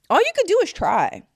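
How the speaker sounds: high, loud exhortation; emphatic, high-pitched outburst; impassioned insistence. Real, not acted. Impassioned plea to keep trying.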